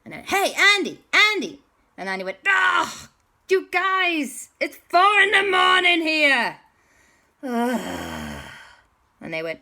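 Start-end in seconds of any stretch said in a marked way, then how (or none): 0.2-1.6 high, loud calls of a name; calling out. Acted. Calling Andy on the phone. 2.5-6.6 high, loud woken-up whine; exasperated complaint. Acted. Andy woken at four a.m. 7.4-8.4 long, low weary groan. Acted. Andy groans at being woken.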